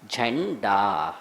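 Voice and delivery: झंडा very slow and low, held retroflex n; class nasal. Shown as a demonstration. Teacher demonstrating the fifth letter, class nasal. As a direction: stretch the n and final aa of झंडा. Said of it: "तो वो इड़ा आएगा".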